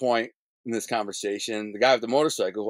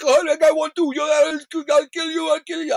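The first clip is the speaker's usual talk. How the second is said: high, loud mock-accented yelling; shrill-pitched, hammed-up shouted threat; angry threat; 6 dB louder than usual. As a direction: imitate a frantic shopkeeper yelling threats. Quoting armed shopkeeper's angry threat.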